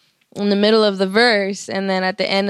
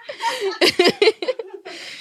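high amused cackle; giggling laughter; 13 semitones higher than usual. Real, not acted. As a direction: laugh it off brightly. Laughing at fake cry idea.

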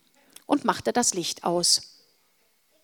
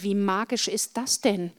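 usual speaking voice

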